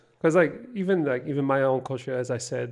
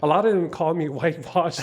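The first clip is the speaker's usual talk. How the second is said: laughing through the words, a bit louder; 4 semitones higher, 7 dB louder than usual. Real, not acted. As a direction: chuckle while you admit it. Laughing about a family nickname.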